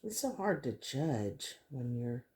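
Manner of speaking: ordinary talk, nothing marked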